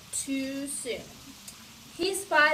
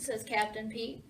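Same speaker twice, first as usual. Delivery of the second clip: plain reading voice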